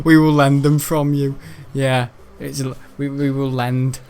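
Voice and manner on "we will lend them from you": mocking quote. Mocking the deputy head's wrong wording.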